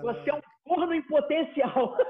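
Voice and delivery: quoting it again through laughter; laughing repeat. Real, not acted. Teller repeating the unforgettable line, laughing. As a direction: say it slowly while laughing. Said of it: "Nunca mais vou esquecer essa frase dele".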